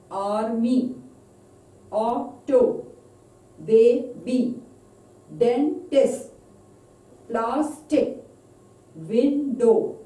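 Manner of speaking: put-on each of "army, auto, baby, dentist, plastic, window" split into two beats, first stressed; two-beat syllable demo. Shown as a demonstration. Teacher drilling two syllable words, "army" to "window" in separate beats. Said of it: "Stress on first syllable".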